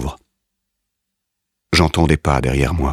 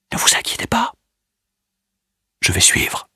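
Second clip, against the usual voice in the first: whispered. A narrator's reading. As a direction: whisper a last comfort. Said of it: "Je lui murmure à l'oreille".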